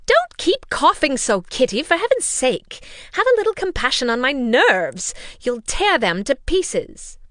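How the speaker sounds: high, loud nervy scold of the mother. A narrator's reading. Mother scolding Kitty for coughing, shrill and loud. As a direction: snap at the coughing girl, nerves frayed. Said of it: "began scolding one of her daughters".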